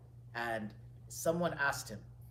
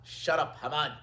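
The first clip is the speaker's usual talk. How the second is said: loud, commanding put-down; sharp rebuke; 3 semitones higher, 10 dB louder than usual. Retold or imitated. Scholar silences the caliph's adviser, sharp loud rebuke. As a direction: silence him with a raised voice.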